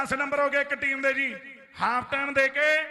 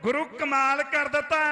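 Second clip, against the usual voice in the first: normal talking voice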